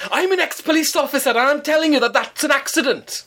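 high indignant mock voice of an older man; pompous neighbour. Neighbour lectures her at the door, high and pompous. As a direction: puff up like an officious ex-copper.

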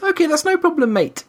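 imitating a chirpy friendly server; lightly mocking the tall barista's cheerful "mate"; cheery barista. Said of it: "She just says".

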